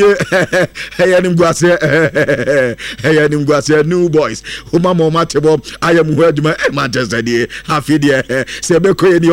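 low, laughing jeer at the losers; mocking laugh, voice dropped low; mocking laughter. Real, not acted. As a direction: laugh through it, gloating. Mocking Manchester United's defeat.

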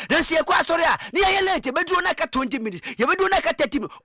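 usual speaking voice

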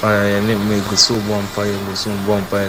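ordinary delivery

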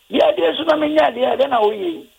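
high, surprised wife's voice asking what he's doing; shocked wife. Retold or imitated. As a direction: squeak it out like a startled bride. Wife's shocked question, retold.

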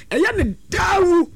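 emphatic shout. Real, not acted. Preaching warning about marriage.

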